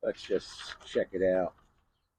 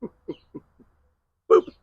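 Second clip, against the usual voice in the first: playful boop; 12 semitones higher, 8 dB louder than usual. Acted. Playful sound after teaser.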